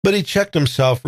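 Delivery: ordinary delivery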